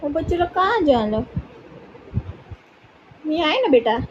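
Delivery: high, sing-song pet talk. Real, not acted. Owner soothing her anxious puppy, high cooing baby talk. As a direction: coo to coax the puppy.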